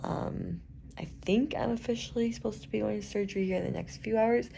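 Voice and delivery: soft, hushed voice, almost whispering; hushed hospital talk. Real, not acted. Talking to the camera from the hospital bed, hushed and tired.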